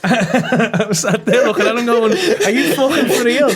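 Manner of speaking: can barely get the words out for laughing. Real, not acted. Laughing while retelling.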